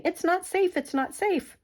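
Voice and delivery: high-pitched mock alarm, like a nervous eye; high alarmed warning. Teacher voicing an eye spotting danger, high mock alarm. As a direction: squeak it out as a pretend warning. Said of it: "jumping out that's saying".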